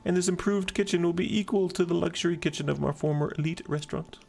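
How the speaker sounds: high-pitched, gushing damsel voice; affected lady, grateful. Acted. Woman thanks keeper for promised kitchen, high affected gratitude.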